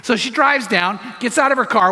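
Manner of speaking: ordinary delivery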